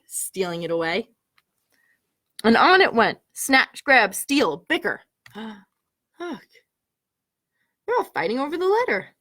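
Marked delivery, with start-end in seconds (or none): none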